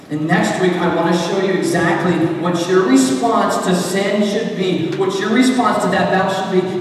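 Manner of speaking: high, loud, driving exhortation; rally the congregation to fight; impassioned preaching. Real, not acted. Preaching at full force.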